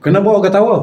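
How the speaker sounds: retold cross challenge; angry. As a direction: imitate an offended spirit demanding an answer. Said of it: "dia marah".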